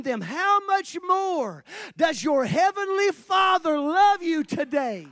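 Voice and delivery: loud, high shouted preaching; preaching at full force. Acted. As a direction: thunder it out to the congregation.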